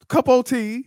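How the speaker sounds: loud, very high mock exclamation; R-Truth. Host imitating R-Truth teasing about Britain, high and loud. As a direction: pipe it up like a silly catchphrase. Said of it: "And then he was like".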